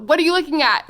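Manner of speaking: indignant, hammed-up "what are you LOOKING at"; mock confrontational. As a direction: call him out loudly for staring. Acts out challenging her husband, escalated.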